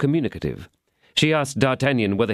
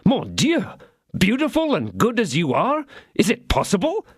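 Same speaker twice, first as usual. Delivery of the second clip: much higher, hammed-up astonishment; feigned surprise; 13 semitones higher than usual. D'Artagnan pretending shock at the lady's enemy, hammed-up surprise. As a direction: overplay the shock on purpose. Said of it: "feigning surprise".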